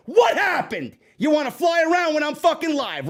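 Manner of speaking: loud, high furious shouting; angry yell. Real, not acted. Streamer yelling at a fly buzzing around the stream, furious shout. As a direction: blow up at the bug that interrupted.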